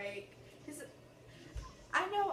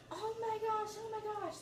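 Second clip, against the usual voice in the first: high squealing fan excitement; fangirling; 11 semitones higher, slower than usual. Retold or imitated. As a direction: gush like a starstruck fan.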